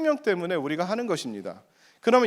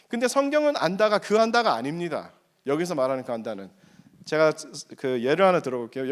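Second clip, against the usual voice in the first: ordinary talk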